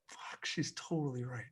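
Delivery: very soft, under the breath; hushed realization. Retold or imitated. Dad privately admits his daughter is right, hushed realization. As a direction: drop to a near-silent aside to yourself. Dad's inward realization, retold.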